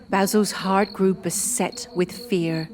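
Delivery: usual speaking voice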